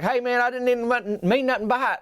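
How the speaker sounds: high, nervous apology in another voice; big guy apologizing after pickles hit; panicked plea. Retold or imitated. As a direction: backpedal fast, scared.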